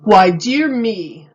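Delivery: a slow, booming wise man. A narrator's reading. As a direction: pronounce it like a sage.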